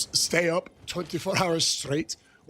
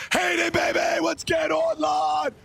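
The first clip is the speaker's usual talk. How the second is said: hype shout; 9 semitones higher, slower than usual. Real, not acted. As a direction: yell it out to the chat, pumped up. Hype greeting.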